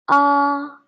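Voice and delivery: AH held high and level; long AH on one high pitch; first tone. Shown as a demonstration. Teacher drilling first line, ah high flat. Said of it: "now let's have a try".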